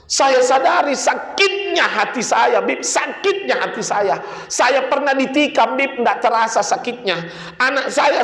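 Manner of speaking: high, intense preaching voice; full-force sermon over music; fiery preaching. Acted. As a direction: drive the repentance home to the crowd. Preaches the father's repentance.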